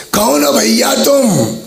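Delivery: high, slow, wary woman's voice; a cautious old woman peering out; woman asking at door. Acted. Old woman asks stranger at her door, high and wary.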